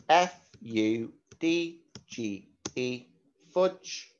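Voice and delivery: slow, letter-by-letter spelling. Shown as a demonstration. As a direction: spell each letter clearly for the class.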